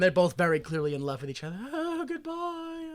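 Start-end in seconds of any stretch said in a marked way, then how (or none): 1.7-2.9 soft, high, drawn-out mock farewell; tender goodbye. Besotted lover parting in the recap, tenderly mocked. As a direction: croon a slow, soft goodbye.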